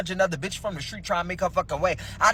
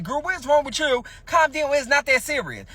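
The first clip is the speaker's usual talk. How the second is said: urgent calming; 10 semitones higher than usual. Retold or imitated. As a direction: raise your voice to settle a panicking friend. Calming panicking shot friend.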